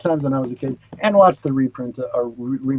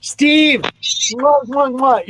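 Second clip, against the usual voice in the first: loud smacking air kisses, high; blowing kisses; 7 semitones higher, 3 dB louder, much slower than usual. Real, not acted. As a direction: blow kisses to a friend. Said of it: "You'll see me kiss another butt".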